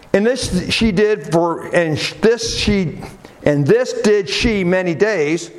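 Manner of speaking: usual speaking voice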